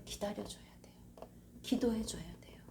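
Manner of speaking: hushed whisper; soft unvoiced whisper. Real, not acted. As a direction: whisper it like a secret. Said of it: "whispers the advice".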